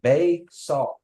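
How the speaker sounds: Bae saw, bae stressed high then falling; Kiowa phrase. Shown as a demonstration. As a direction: repeat Bae saw for the class. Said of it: "You say".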